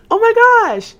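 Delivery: high delighted gasp; surprised squeal. Real, not acted. Surprised at turning nineteen.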